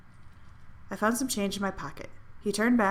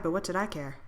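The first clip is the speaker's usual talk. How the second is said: ordinary delivery, nothing marked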